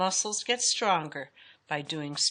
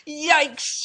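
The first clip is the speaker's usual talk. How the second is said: high, loud startled cry; frightened yelp; 10 semitones higher, 8 dB louder than usual. A narrator's reading. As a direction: yelp it in alarm. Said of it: "She was very frightened".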